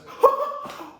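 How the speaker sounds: high, breathy burst of laughter; short put-on laugh; imitated laugh. Panda laughs at the villain for a second, high giggle. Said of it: "He laughs for a second".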